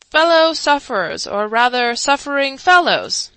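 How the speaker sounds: pompous orator. A narrator's reading. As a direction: address the crowd grandly. Chancellor begins his speech.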